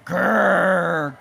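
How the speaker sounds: grumpy panda growl; storybook "grrr" of frustration; grumbled growl. Acted. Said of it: "grumbled the pandas".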